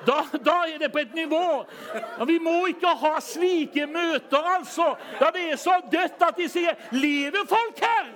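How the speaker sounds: high, loud shouting preacher; full-force preaching. Real, not acted. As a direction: rouse the congregation with power.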